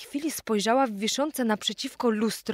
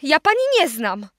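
insistent; 5 semitones higher, 5 dB louder than usual. A narrator's reading. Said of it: "powtarzała".

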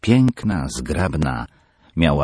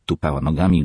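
usual speaking voice

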